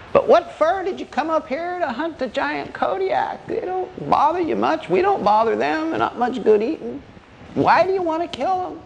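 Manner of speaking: high-pitched backwoods granddad; old man. Acted. Old man challenges the bear hunters, high old-timer voice. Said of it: "the old man thought about that".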